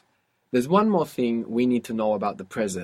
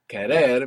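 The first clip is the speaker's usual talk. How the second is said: querer with the last syllable hit louder; stress on the end; 6 dB louder than usual. Shown as a demonstration. Teacher showing where the accent falls in querer. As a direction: lean on the ending of querer, loudly. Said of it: "we have the accent on the end".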